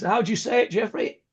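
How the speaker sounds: ordinary talk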